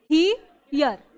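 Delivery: "ही, यर" split in two with a pause between; syllable-by-syllable HERE. Shown as a demonstration. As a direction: sound out "ही" then "यर" for learners. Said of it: "बोलते हैं हियर". ("ही, यर" को दो टुकड़ों में तोड़कर)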